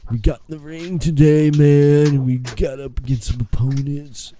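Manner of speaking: lightly voiced spacey dude; laid-back dude. Co-host as a dude wrestler recounting the match, lightly put on.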